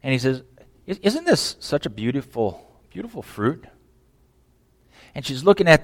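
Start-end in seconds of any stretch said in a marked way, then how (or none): 0.9-3.7 much softer, seductive serpent voice; tempting snake. Pretend. The snake tempting the woman with the fruit, hushed and seductive. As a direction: coax her like a serpent.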